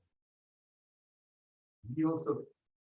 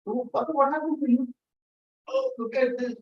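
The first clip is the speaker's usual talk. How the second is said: a higher, lightly voiced boy calling to his father; worried young boy; 11 semitones higher, 4 dB louder than usual. Young son worried about his hurt father, higher boyish voice. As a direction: play the son who rushes in alarmed.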